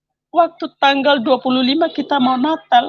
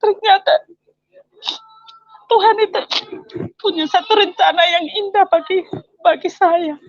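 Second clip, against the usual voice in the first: tearful, raised voice thanking God; crying thanks; 5 semitones higher than usual. Retold or imitated. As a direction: pray through tears, voice lifted high. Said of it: "Saya hanya menangis". (menangis sambil bersyukur, suara tinggi)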